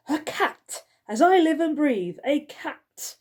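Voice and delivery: high, loud outraged exclamation; shocked cry. Acted. Skullface discovers a cat behind the door, crying out. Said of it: "cried Skullface".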